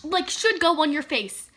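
ordinary delivery

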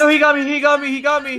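high loud dismay; panicked. Real, not acted. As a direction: cry out as you're caught. Caught by the enemy.

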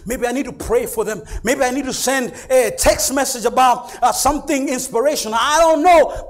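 loud, high, driving sermon delivery; impassioned preaching. Real, not acted. Preacher voicing a husband's devotion and pleas to the Lord, impassioned preaching. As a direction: cry it out to the whole congregation.

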